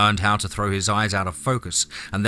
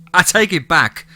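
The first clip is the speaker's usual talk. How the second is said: higher, louder playful tease; mock verdict, raised and emphatic; teasing; 6 semitones higher, 7 dB louder, slower than usual. A narrator's reading. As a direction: tease him, punching it up high and loud. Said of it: "she teased her stepfather".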